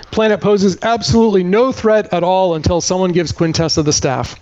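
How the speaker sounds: usual speaking voice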